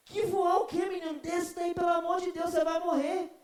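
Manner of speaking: high, loud frantic scolding; grandma begging the boy to come down; hammed-up frantic grandmother shouting; panicked grandma.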